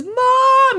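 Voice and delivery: put-on high, loud kid's yell for mother; child yelling for mom. Calvin shouting for his mom, high and loud. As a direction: call out shrill as a panicked child. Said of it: "he goes".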